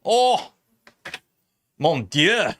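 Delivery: mock French. Mock French after croissants.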